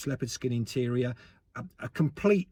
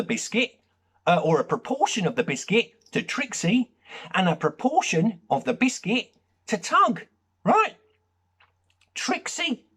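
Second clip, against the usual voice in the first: much higher and louder, building outrage; rising rant; 8 semitones higher, 3 dB louder than usual. Real, not acted. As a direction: work yourself into a lecture frenzy. Escalating rant about biscuits.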